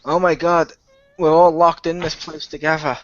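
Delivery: ordinary delivery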